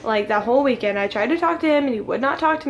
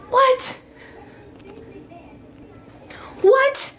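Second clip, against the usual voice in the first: squeaky, much higher shocked "What? What?"; shocked disbelief; 7 semitones higher than usual. Retold or imitated. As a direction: replay the moment your jaw dropped, pitch shooting up. Learns they broke up.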